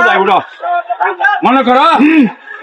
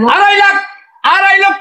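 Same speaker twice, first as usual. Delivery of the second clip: auctioneer; 4 semitones higher than usual. Acted. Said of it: "গাড়ি বিক্রি হবে নিলামে".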